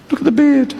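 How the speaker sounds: high squeaky voice of fear; paranoid inner voice. Retold or imitated. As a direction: shriek the worry like a frightened busybody.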